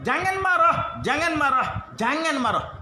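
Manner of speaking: high, pressing repetition of don't be angry; urgent preacher's plea, fading to a laugh; emphatic warning. Acted. Repeating the hadith emphatically.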